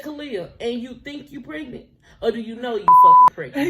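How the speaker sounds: ramp up into a shocked mother's yell; mom's shout. Retold or imitated. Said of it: "She said".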